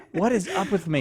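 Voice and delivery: high laugh, then the words still laughing; laughing at himself. Real, not acted. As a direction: laugh through the line at your own mistake. Host laughs at repeated name mix-up.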